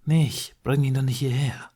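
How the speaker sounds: mild sharp hiss at his sister. A narrator's reading. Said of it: "before hissing".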